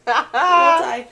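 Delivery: loud laughing cheer; laughing shout. Real, not acted.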